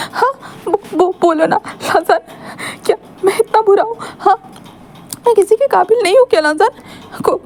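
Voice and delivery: crying Wing. Acted. Wing sobbing to Lancer that nobody loves him, crying. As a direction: beg through tears, voice cracking. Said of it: "आप इतना मत रोइए ना".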